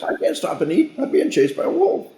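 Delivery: very high, squeaky, frantic little deer; panicked deer voice. Retold or imitated. A chased deer refusing to stop for water, squeaky high panic. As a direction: squeal it like a hunted deer who can't stop.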